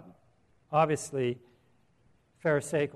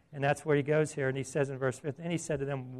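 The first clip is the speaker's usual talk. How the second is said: ordinary talk, nothing marked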